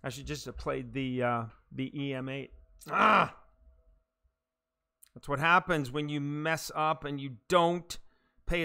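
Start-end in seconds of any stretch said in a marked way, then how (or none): 2.9-3.4 a sharp, loud yelp of annoyance; frustrated yell. Real, not acted. Gamer annoyed at his own golf mistake, loud yelp.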